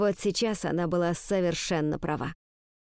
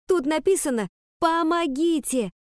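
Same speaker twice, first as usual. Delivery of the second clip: urgent cry; 8 semitones higher, 3 dB louder than usual. A narrator's reading. Girl revealing the letter's hidden message, urgent cry. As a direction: call it out high, stretching the plea. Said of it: "крикнула Ариадна".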